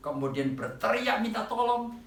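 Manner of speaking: normal talking voice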